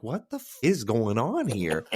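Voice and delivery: a hint of mock disbelief, slow; lightly voiced what the heck; baffled. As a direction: puzzle over the search result. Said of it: "And I'm like".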